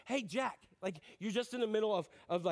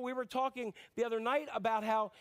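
ordinary talk, nothing marked

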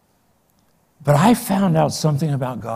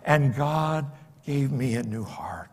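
whisper; 6 dB quieter than usual. Real, not acted. Intimate testimony after loud preaching.

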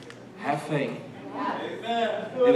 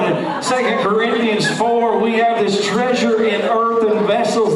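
loud, raised preacher's voice; full-force preaching; 5 semitones higher, 6 dB louder than usual. Real, not acted. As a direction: drive it out to the congregation, high and forceful.

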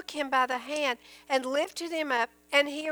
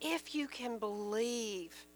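soft, slow and reflective; hushed repeat; 8 dB quieter, slower than usual. A narrator's reading. Preacher repeating Jesus' words to the congregation, hushed. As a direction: drop the volume and let "believe" sink in.

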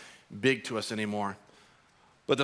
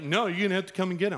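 put-on high, fast teasing kid; bratty taunt; 7 semitones higher, much faster than usual. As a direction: rattle off a brat's dare. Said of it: "He's like".

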